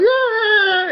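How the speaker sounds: high-pitched hammed-up wail; mock crying. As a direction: imitate a bawling crier. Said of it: "he's basically crying".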